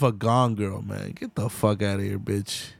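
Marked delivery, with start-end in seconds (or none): none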